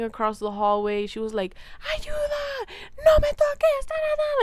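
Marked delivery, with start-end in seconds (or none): 1.9-4.4 mock screaming. Lady across the hallway begging for help, imitated as a high scream. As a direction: imitate a frightened patient screaming down the hall. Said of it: "like, screaming".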